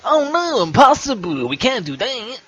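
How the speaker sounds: high, loud cartoonish panic; little blue guy. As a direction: wail it as a squeaky game character in mock dismay. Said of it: "He's like".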